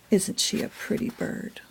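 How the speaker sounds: soft unvoiced aside; whisper. Real, not acted. Reader whispering an aside to the child, hushed. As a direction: whisper it to the listener.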